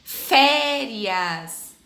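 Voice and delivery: Férias with the É said open, stretched out; open E sound. Shown as a demonstration. Teacher showing pupils the open É in Férias. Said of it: "que tem o mesmo som, som aberto".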